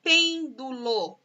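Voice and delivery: "pêndulo" drawn out slowly: pen-du-lo; teacher modelling a word; slow, syllable by syllable. Shown as a demonstration. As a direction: stretch out every syllable for the children. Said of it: "nós temos aqui a palavra".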